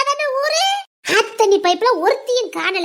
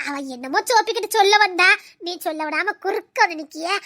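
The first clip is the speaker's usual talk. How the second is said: ordinary talk, nothing marked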